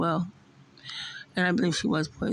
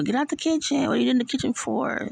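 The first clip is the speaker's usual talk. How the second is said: high-pitched scolding like mom; mimicking mom's scolding; 7 semitones higher than usual. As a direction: scold someone out of the kitchen, voice raised high. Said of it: "mimicking my mom indirectly".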